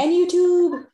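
high bright callout; playful reminder. Real, not acted. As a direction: chime in loudly, teasing.

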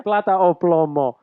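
slow mock Spanish accent line; hammed-up Narcos Spanish accent. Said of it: "Plata or plomo?".

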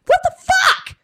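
very high shouted what the fuck; mock shriek. [name] reacting in shock, high screech. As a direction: screech it in disbelief.

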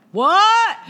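high, loud startled what; hearing a girl credit Flying Sober, shocked. Retold or imitated. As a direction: snap back in disbelief. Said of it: "And I went".

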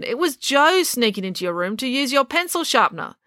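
high-pitched indignant explanation; protesting. A narrator's reading. Finn tells April it was Joe, protesting. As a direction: shift the blame, pitched high. Wrongly accused brother protests.

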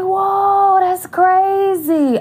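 high, slow drawn-out whoa; amazed realization. Retold or imitated. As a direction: stretch it out, stunned by herself. Realizing her fear, talking to viewers.

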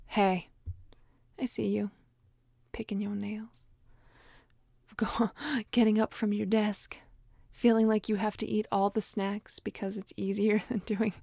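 hushed whisper, catching yourself in the act. Acted. Speaker whispers to herself, noticing sabotage, hushed whisper. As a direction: whisper it like spotting a sneaky habit.

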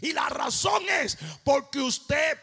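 loud, ringing preacher's cadence; fiery sermon delivery; preaching at full force. Acted. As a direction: drive the faith message home. Preaching about prayer and faith.

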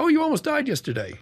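hammed-up high cheerful nurse; mimicking the nurse. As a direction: play the nurse breezily announcing he nearly died. Imitating the nurse's cheerful news.

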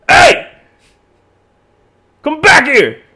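full-force high yell after a car; retold furious shout. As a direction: reenact bellowing at someone driving away. Retelling yelling after driver.

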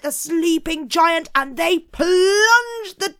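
high, loud, thrilling storytelling; excited narration. A narrator's reading. Narrator telling how the stake hits the eye, excited and loud. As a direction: build to the gory moment with relish.

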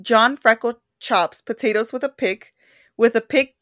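slow, deliberate tongue twister; slow careful read. Shown as a demonstration. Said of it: "reading the tongue twister".

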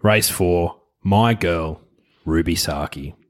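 ordinary delivery

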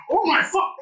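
high-pitched mock alarm; imitated panic. Coach learns he slept fourteen hours, high mock panic.

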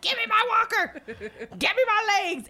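high, loud comic demand; mock outrage. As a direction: holler it like you're hammering up a fight. Acted mock outrage, ready to fight.

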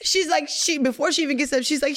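usual speaking voice

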